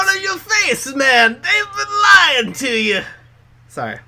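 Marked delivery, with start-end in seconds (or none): 1.0-3.0 much higher and louder, slow, crying shout; mock cult leader promo. Co-host parodying a creepy wrestling promo, loud high slow wail. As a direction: wail the revelation like a hammed-up prophet.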